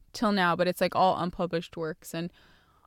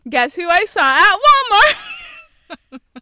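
very loud, high parody teacher; teacher's announcement; 11 semitones higher, 14 dB louder than usual. Acted. Teacher telling the class next day, loud mock announcement. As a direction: belt it out to a whole class, hammed-up. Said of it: "the next day in class".

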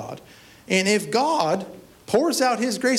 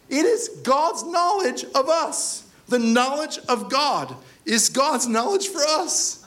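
slow emphatic; 6 semitones higher, slower than usual. Real, not acted. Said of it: "That's crazy when you read that".

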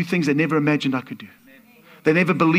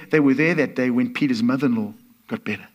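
ordinary talk, nothing marked

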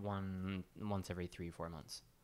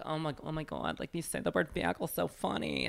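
high, put-on gushing voice; exaggerated high mimicry; mock amused onlookers; 7 semitones higher than usual. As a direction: imitate tickled onlookers, pitched way up. Mocking reaction of people retold.

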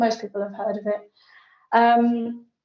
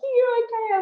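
friend's text; 13 semitones higher than usual. Retold or imitated. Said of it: "She's like".

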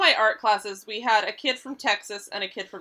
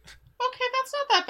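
high character voice; 12 semitones higher, 4 dB quieter than usual. Acted. Player voices character hearing of cat.

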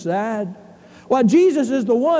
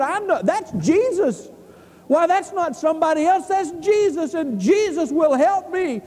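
high-pitched, insistent blind beggar; Bartimaeus; 6 semitones higher than usual. Acted. Blind beggar answering the crowd that hushed him, insistent pleading. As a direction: plead high, sure of being helped. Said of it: "And he said".

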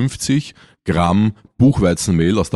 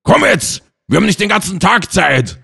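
mock snapping old man; 11 semitones higher than usual. Old man snaps at his wife in the nursing home, mock angry shout. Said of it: "faucht sie an und sagt".

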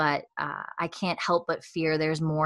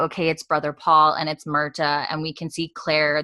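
ordinary delivery

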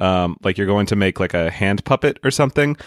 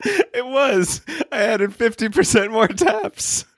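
much higher, mock proud; high joking voice; 11 semitones higher, slower than usual. Real, not acted. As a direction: brag in a squeaky high voice. Joking about added pizzazz.

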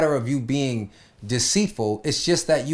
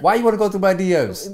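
higher, louder mock-indignant question; indignant challenge; 7 semitones higher, 5 dB louder than usual. Partner challenged over private messages, mock indignant. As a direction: act out pushing back at the snooping.

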